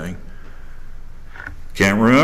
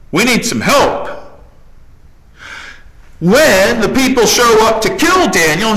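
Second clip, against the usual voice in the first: high, loud retelling from the pulpit; impassioned sermon storytelling; fiery preaching; 11 semitones higher, 3 dB louder than usual. Acted. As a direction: preach Daniel's boldness to the crowd.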